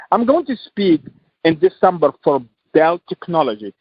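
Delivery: ordinary delivery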